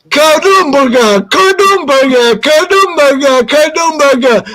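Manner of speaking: high, rhythmic clattering imitation; galloping chair noise. Chair gallops down the slope, imitated clatter. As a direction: chant the bumps of a galloping chair.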